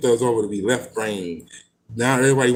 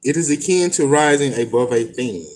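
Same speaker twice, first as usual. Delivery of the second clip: measured, deliberate book reading; reading aloud. Acted. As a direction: read it out like scripture. Reads passage from a book.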